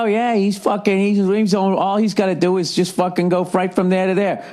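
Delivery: high whiny voice of the envious runner; mocking envious guy. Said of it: "you always think that other guy".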